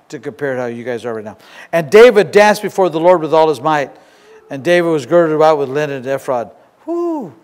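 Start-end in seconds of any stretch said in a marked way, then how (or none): 1.7-6.5 lightly raised scripture read. Acted. Reads scripture aloud. 6.8-7.3 whew. Real, not acted. Preacher exhales after reading.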